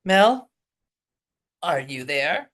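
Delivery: ordinary talk, nothing marked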